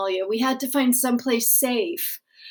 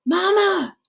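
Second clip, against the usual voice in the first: put-on high, calling child voice; child calling Mama; 8 semitones higher, 3 dB louder than usual. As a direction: call out high for your mother.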